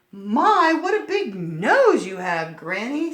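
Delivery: put-on high, loud startled child voice; surprised little girl. Red noticing the big nose, high loud child voice. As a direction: exclaim like a small girl.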